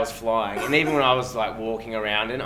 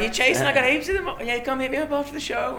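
high mock dealer pitch; hammed-up dealer voice; imitating dealer talk; 12 semitones higher than usual. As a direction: pitch way up, selling on the sly. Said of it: "They're like".

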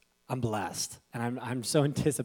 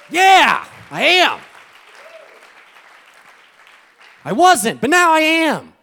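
much higher, louder yell of readiness; pumped shout; 16 dB louder, slower than usual. Retold or imitated. Pastor shouting back to his cheering wife. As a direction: answer the hype at full volume.

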